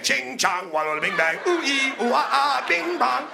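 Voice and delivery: hammed-up mock Chinese gibberish. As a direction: ham up a caricatured Chinese babble.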